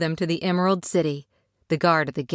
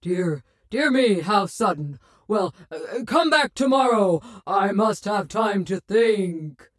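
flustered Oz voice. A narrator's reading.

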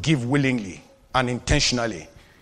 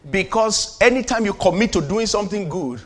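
ordinary talk, nothing marked

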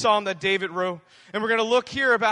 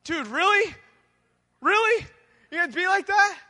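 high, incredulous "really?"; can't believe your friend is doing this; exasperated disbelief; 9 semitones higher, 5 dB louder than usual. Retold or imitated. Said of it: "And I'm just like".

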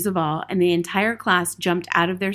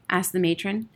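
plain reading voice

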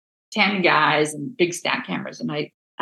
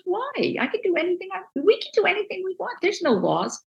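much higher, gleeful outburst; excited mentor; 12 semitones higher, faster than usual. Retold or imitated. Mentor brushing off the Xerox rule, high and delighted. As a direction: toss the rules aside with high delight.

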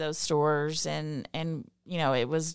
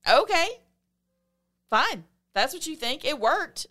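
defiant; 9 semitones higher, 4 dB louder than usual. Real, not acted. Defiant retort to skeptics.

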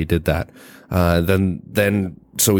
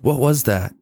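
startled woman; 6 semitones higher than usual. Acted. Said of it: "And then Aniston goes".

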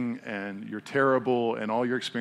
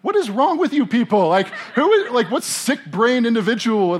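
much higher, loud, laughing incredulous outburst; exasperated disbelief; 11 semitones higher, 9 dB louder than usual. Retold or imitated. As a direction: blow up at the absurdity, half laughing. Exasperated retold reaction.

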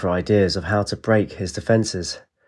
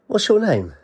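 put-on high girl voice; 10 semitones higher than usual. Child voice, much higher.

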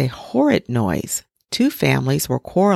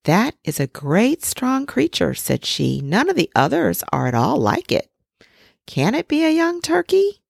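lightly voiced mother, a bit higher; doubtful mother duck; 8 semitones higher than usual. A narrator's reading. Mother duck eyes the ugly duckling, mildly doubtful. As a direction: puzzle over the strange big chick.

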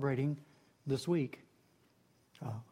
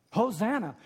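normal talking voice

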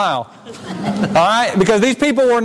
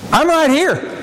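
proud, pushed-out claim; boastful; 4 semitones higher, slower than usual. Shown as a demonstration. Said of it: "I said that almost in a boastful way".

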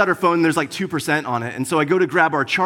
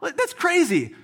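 much higher, slow, incredulous; retold disbelief; 13 semitones higher than usual. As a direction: act baffled at the sold-out shelf, pitch way up. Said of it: "I'm like".

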